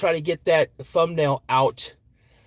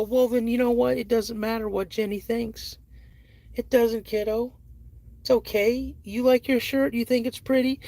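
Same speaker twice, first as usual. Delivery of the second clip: reassuring dad; 9 semitones higher than usual. Retold or imitated. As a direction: reassure a sad little girl. Dad comforting daughter.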